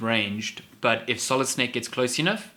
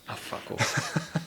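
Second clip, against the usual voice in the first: exasperated groan; 6 dB quieter, much slower than usual. Real, not acted. Groaning at a pun.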